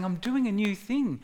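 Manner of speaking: God inviting his people to look, lifting excitedly; fatherly excitement. Retold or imitated. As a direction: voice lifting high on the question, eager. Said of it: "There's an excitement in that".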